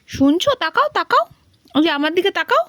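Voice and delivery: high sing-song coaxing, rising. Real, not acted. Owner begging her dog to look at her, in high coaxing baby talk. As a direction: lift the voice to win the dog's eyes.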